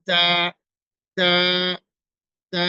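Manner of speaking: তা said thin and light, tongue tip on the teeth; a thin, light তা, repeated slowly; thin ta sound. Shown as a demonstration. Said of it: "চিকন ভাবে উচ্চারণ হবে". (পাতলা করে তা উচ্চারণ)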